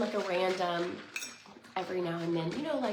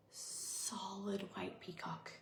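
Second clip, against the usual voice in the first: hushed awe; 11 dB quieter, much slower than usual. Real, not acted.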